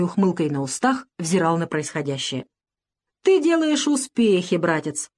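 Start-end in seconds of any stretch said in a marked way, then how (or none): 3.2-5.1 sneering. A narrator's reading. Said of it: "продолжил Леонсио с издёвкой в голосе".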